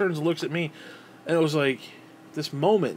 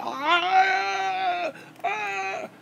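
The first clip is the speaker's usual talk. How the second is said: high mock scream of pain; imitated child scream. Boy being beaten by his dad, high mock scream. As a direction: shriek like a kid being beaten. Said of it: "Daniel's screaming".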